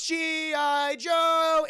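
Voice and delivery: slow, high, loud chant; drawn-out shouted cheer; mock rallying cheer. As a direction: cheer it like a crowd of soldiers. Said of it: "everyone goes".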